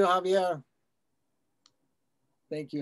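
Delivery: ordinary talk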